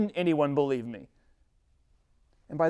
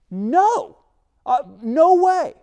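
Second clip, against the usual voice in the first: yelped no, much higher and louder; horrified refusal; 11 semitones higher, 11 dB louder, much slower than usual. Acted. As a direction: recoil from the snake with a panicked shout. Moses told to grab snake.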